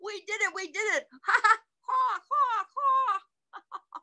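very high, gleeful squeaky voice; squeaky triumph turning into mock laughter; laughing squirrel. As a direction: celebrate like a giggling squirrel. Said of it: "laughed Squirrel".

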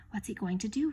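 usual speaking voice

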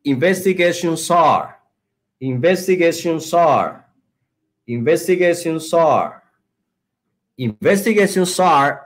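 "investigations are" linked as "investigation-sar"; linking words. Shown as a demonstration. Teacher drilling students to repeat, "investigations are" linked by the S. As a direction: repeat "investigations are" as one joined unit. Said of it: "el truco de pronunciación, linking words".

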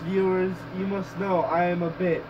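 ordinary talk

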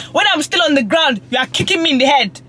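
pleading through tears; tearful. Real, not acted. Upset woman crying while recounting a friend's hurtful words. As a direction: voice rising and breaking into real tears. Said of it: "Fam, I'm literally crying".

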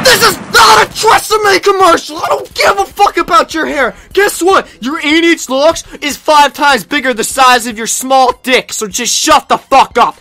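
an all-out rant; furious shout. Acted. Raging at the other commentator.

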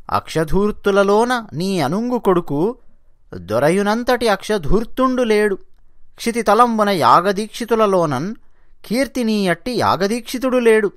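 slow, measured verse chanting; lilting Telugu poem recital; poem recitation. Acted. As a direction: intone the verse slowly.

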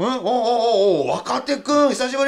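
happy surprise at seeing him; excited greeting. Acted. Greets junior actor.

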